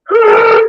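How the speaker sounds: loud, high roaring yell. Retold or imitated. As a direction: roar out to push through the pain. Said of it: "I went".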